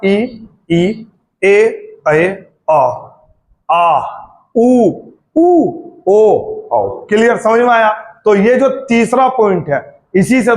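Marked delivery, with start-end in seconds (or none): 0.0-7.0 ई, ए, आ, ऊ, ओ each sounded out separately; vowel sounds demo. Shown as a demonstration. Teacher recapping the vowels, sounding each out clearly. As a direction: sound every vowel ई ए आ ऊ ओ slowly one by one. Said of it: "क्या बोल के देखा?".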